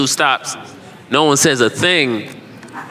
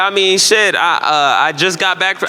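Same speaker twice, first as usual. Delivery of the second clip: normal talking voice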